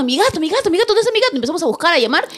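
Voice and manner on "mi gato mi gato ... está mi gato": higher frantic search for the cat, retold; retold panic. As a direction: call out frantically. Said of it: "Le digo a mi hermano".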